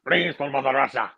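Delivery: mock-Russian accent. Joining the joke about the audition, in a Russian accent. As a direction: put on a comic Russian accent. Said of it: "he had a Russian accent".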